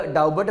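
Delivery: डाउबट with the b voiced; wrong pronunciation. Shown as a demonstration. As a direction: misread doubt as डाउबट, b audible. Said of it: "वो बिल्कुल गलत है".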